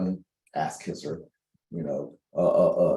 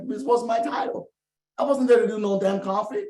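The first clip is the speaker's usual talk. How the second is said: worked-up rant; 11 semitones higher than usual. Real, not acted. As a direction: build up the outrage and let it ride high. Heated rant about own anger.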